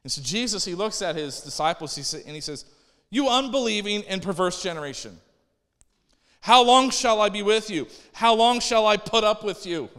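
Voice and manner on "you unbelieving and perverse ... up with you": raised, louder, fed-up rebuke of a whole generation; exasperated rebuke. Retold or imitated. Jesus rebuking his faithless disciples, exasperated and loud. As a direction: let Jesus' frustration ring out, loud and high. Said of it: "You can kinda hear his tone in these words".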